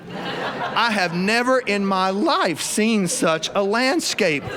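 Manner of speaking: ordinary delivery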